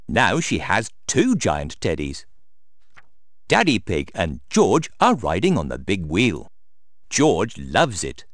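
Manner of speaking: usual speaking voice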